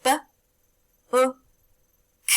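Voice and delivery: "B, U, K" as three separate sounds; sounding out words. Shown as a demonstration. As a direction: segment "B, U, K" sound by sound with pauses. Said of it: "One more time".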